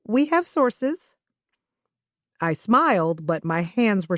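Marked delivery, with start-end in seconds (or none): 0.0-1.1 coy bluff. Acted. Nervous bluff with smile.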